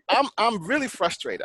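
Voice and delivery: frustrated words through a laugh; laughing while frustrated. Real, not acted. Venting about mask fights to friends, laughing. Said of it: "I'm kinda laughing".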